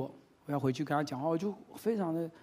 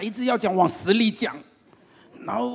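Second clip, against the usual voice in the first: ordinary talk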